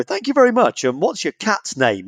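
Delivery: lightly voiced service rep; mock call-centre agent.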